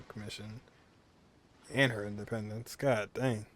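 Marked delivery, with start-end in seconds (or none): none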